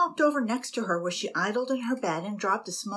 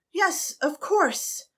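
much higher, slow soothing yes; placating; 10 semitones higher, 4 dB louder, much slower than usual. A narrator's reading. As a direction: soothe him slowly. Annabel placating.

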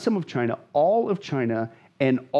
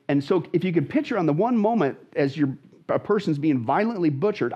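ordinary talk

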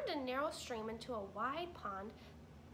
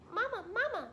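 put-on much higher, louder baby bird; gosling calling mama, much higher; hungry gosling; 11 semitones higher, 8 dB louder than usual.

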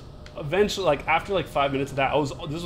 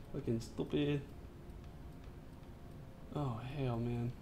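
much softer grumble; muttering; 5 semitones lower, 15 dB quieter than usual. Real, not acted. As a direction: mutter it under breath. Muttering after fight.

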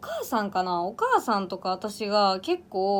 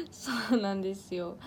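usual speaking voice

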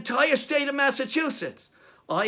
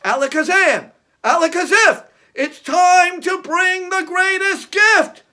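put-on kids party magician; 8 semitones higher, 10 dB louder than usual. Magic words incantation.

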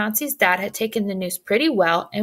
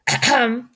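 sharp interrupting ahem; pointed throat-clear; 3 semitones higher, 4 dB louder than usual. Acted. Red cuts off tactless Jack, pointed throat-clearing.

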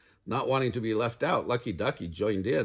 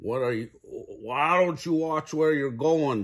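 indignant goose, a bit higher; honking goose; 4 semitones higher, 4 dB louder than usual. A narrator's reading. Goose scolds the birds who ran into her, honking. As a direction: honk it out like an affronted goose. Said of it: "she honked".

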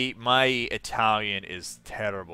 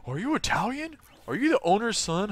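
much higher mock customer voice; imitated curious customer; 10 semitones higher than usual. Customers asking a restaurant worker about his background, mock high voice. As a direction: put on a high-pitched, inquisitive customer. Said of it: "all our customers think I speak".

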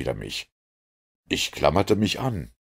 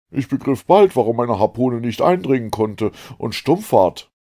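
loud, raised, emphatic harpooner; Ned; 6 semitones higher, 6 dB louder than usual. A narrator's reading. Ned tells why his harpoon failed, forceful voice. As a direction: explain it with forceful conviction.

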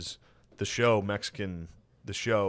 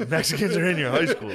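laughing mock Trump; 8 semitones higher, 6 dB louder than usual. Echoing Trump bit laughing.

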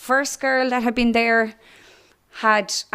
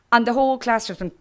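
normal talking voice

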